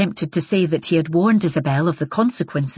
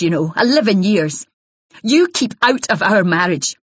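loud, higher, raw accusing rant; hostile accusing wife; 3 semitones higher, 4 dB louder than usual. Acted. Jealous wife warns Isabel off her husband, raw and hostile. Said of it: "a rawness, voice began to be choked".

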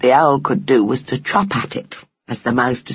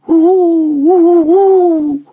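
high, loud, long wavering "ooh"; eerie owl hoot; 8 dB louder, much slower than usual. A narrator's reading. Owl hooting from the upper branches, eerie and wavering. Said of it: "an eerie, wavering call".

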